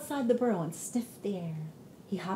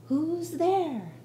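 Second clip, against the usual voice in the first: higher hooting owl call; 7 semitones higher than usual. Acted. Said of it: "Friend Owl asked".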